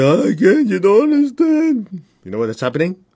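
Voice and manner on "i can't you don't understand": much higher, slow mock whine; whiny protest mimicry. Teacher mimicking a complaining person, high whine. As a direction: whimper the protest like a helpless child. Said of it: "When a person says".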